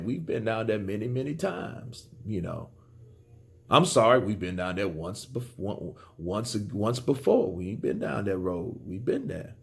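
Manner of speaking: deflated mock of a fighter with no energy; downbeat monotone. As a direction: mumble it like a tired, beaten man. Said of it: "He sounded like he was kinda down".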